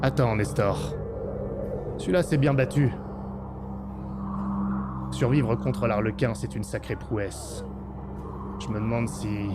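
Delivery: low, calm sergeant voice; drill sergeant. Acted. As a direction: hold them back, low and measured.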